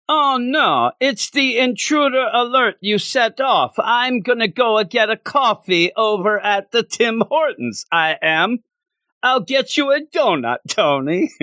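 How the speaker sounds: high, hammy Canadian voice; Canadian accent. Retold or imitated. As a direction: sell the Tim Hortons Canadian bit. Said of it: "That's how I think everybody in Canada talks".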